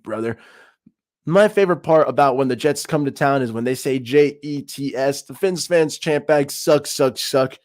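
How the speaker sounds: normal talking voice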